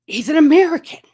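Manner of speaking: high, loud, thrilled exclamation; astonished excitement. Retold or imitated. Passenger taking in the American Pope, astonished.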